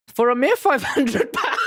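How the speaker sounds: high theatrical pitch dissolving into laughter; over-the-top salesman, cracking up at the end; hammed-up detective. Detective naming his price, hammed up and breaking into laughter.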